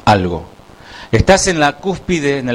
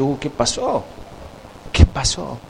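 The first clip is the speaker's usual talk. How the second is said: mock alarm, dropped to a soft voice; hushed alarmed reaction; 8 dB quieter than usual. Neighbour hearing screams and crying outside, hushed alarm. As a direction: softly wonder what just happened.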